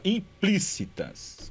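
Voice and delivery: Implícitas drawn out slowly to mark each syllable; slow syllable-by-syllable reading. Shown as a demonstration. Teacher spelling out a word for students, very slow. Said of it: "a primeira alternativa lá".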